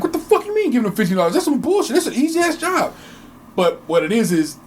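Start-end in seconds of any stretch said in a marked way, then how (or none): 0.0-2.9 mocking outrage. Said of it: "They're like".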